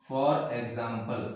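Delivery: for example stretched very slowly; drawn-out dictation. Shown as a demonstration. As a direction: spell it out slowly for the class. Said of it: "teacher repeats the term".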